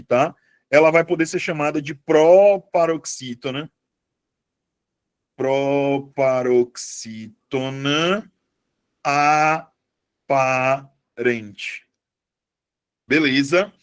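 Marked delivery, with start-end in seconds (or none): 5.4-11.8 very slow, drawn-out syllables with long gaps; slow dictation. Shown as a demonstration. Teacher writing the other name for students, slow dictation. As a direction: stretch PROPAROXÍTONA APARENTE out piece by piece.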